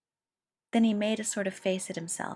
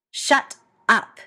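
loud sharp snap; snapped; 4 semitones higher, 10 dB louder than usual. A narrator's reading. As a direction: cut him off hard. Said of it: "Ed snapped".

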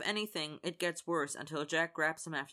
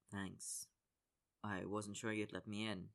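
quiet; 6 semitones lower, 8 dB quieter than usual. Acted. Connor arrives, subdued apology.

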